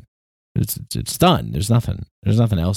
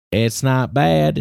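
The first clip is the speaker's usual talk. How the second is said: mock-cheery not bad; 5 semitones higher, slower than usual. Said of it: "I feel like I said that weird".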